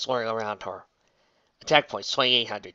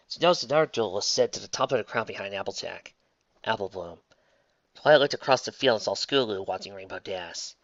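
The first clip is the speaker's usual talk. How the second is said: ordinary delivery, nothing marked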